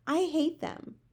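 high, pained outburst; vehement hatred. A narrator's reading. Sophie condemns the man-eating giants, vehement.

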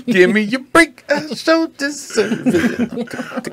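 high, slow hammed-up diva; mock diva line. Hamming it up to the friends, a mock diva line. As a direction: belt it like a sitcom star.

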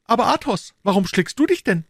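puzzled musketeer; Aramis. Acted. Aramis voiced.

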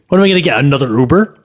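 ordinary delivery

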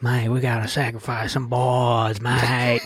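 put-on lightly done Aussie surfer accent; Australian accent. Joel rallying the kids, light Australian accent. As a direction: toss off mate the Australian way. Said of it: "so Joel's like".

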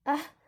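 short, high startled gasp; startled yelp. A narrator's reading. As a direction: jump with a quick "ah". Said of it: "She jumps when she feels".

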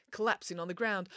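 ordinary delivery, nothing marked

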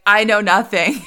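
loud, playful mimicry of a protest; animated catchphrase. Answering husband with the overheard line, playfully animated. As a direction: belt out the borrowed catchphrase emphatically. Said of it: "And I would be like".

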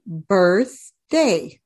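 "birthday" slowly, as two separate beats; split into syllables. Shown as a demonstration. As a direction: break "birthday" into birth, pause, day. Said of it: "you drop your chin".

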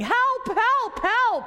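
high, loud panicked cries for help; yelling for help. Acted. Man in gorilla suit cornered by the lion, high loud panicked yell. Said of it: "the gorilla starts yelling".

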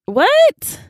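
very high squeaked question; hammed-up squeal of disbelief; incredulous. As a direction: shoot the pitch up in disbelief. Incredulous reaction to nobody caring.

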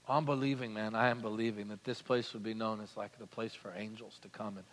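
soft prayerful preaching. Acted. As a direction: pull it down to a near hush.

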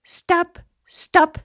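put-on high, loud scream of an old oak; a loud, high cry for help. A narrator's reading. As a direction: shriek at him to stop. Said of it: "skreg egetræet".

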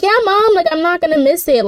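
put-on high, eager teen promising mom; eager reassurance. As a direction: pitch it way up like an overeager kid.

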